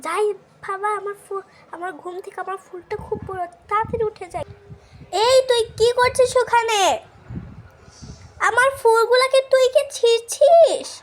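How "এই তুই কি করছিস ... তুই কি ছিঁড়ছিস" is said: high, loud furious yelling; angry scolding. Acted. Mother yelling at daughter caught picking her flowers. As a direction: shout her down for wrecking the flowers.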